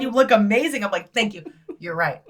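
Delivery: normal talking voice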